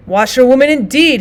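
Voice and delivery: loud, scornful outburst; indignant, reckless cry; reckless shout. A narrator's reading. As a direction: shout it back, full of reckless defiance. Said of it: "he shouted recklessly".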